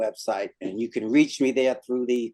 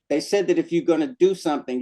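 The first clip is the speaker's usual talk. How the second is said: normal talking voice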